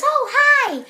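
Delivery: very high, sing-out hello; exaggerated chummy greeting; mock friendly greeting. Mocking the officer's friendly greeting.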